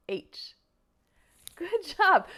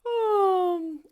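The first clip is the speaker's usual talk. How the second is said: big falling vocal yawn; long yawny sigh sliding down; pretend yawn; 6 semitones higher, 5 dB louder than usual. Shown as a demonstration. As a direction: open into a loud, high yawn. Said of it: "it's a yawn".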